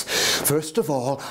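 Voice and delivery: usual speaking voice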